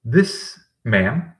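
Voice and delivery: usual speaking voice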